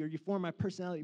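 ordinary talk

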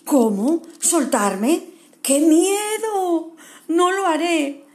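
high, loud, slow frightened wail; cling on in terror; scared koala. Acted. Kevin refuses to let go of the tree, terrified. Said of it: "Kevin estaba asustado".

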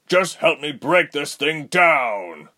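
loud, high, drawn-out plea for help; urgent cry. A narrator's reading. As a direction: cry out in desperation. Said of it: "Jonin cried".

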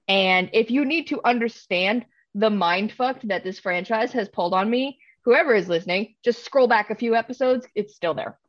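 ordinary talk, nothing marked

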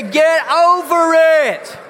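loud, slow call to the congregation; slow rallying shout. Shown as a demonstration. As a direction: belt it out for the whole room. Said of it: "Turn to your neighbor on the other side".

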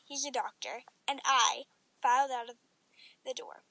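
usual speaking voice

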